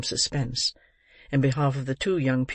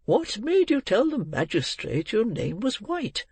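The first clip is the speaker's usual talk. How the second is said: put-on questioning old gentleman; 8 semitones higher than usual. Old gentleman questions boy's story.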